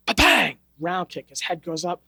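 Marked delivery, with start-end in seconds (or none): 0.1-0.6 loud, higher "Ba-bang" like a strike; imitated punch sound. Imitating the hits in a fight.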